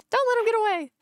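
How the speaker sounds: high excited cheer from the gallery. Acted. Gallery fan urging the lawyer on, high and excited. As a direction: squeal it like an eager fan.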